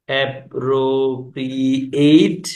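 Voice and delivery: "Appropriate" very slowly, syllable by syllable; drawn-out syllables. Shown as a demonstration. Teacher spells out the example word, very slow separated syllables. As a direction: stretch out each syllable of "appropriate", rising at the end.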